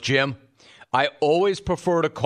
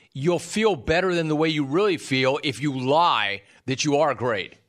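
ordinary delivery

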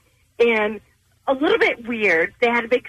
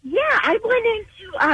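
bubbly; 11 semitones higher, slower than usual. Real, not acted. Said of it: "You sound so bubbly".

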